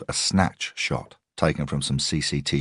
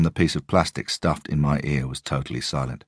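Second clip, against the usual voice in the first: usual speaking voice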